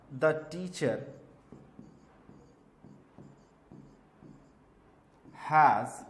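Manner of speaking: The teacher has explained words meaning, one word at a time with long pauses; slow dictation to students; dictating. Shown as a demonstration. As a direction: dictate the sentence word by word as you write it. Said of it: "मैंने एक question लिखा".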